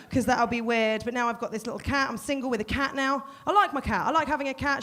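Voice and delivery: ordinary talk, nothing marked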